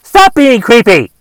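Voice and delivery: loud exasperated yell; shouting. Real, not acted. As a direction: yell it at the creep. Ranting at middle-aged Snapchat user.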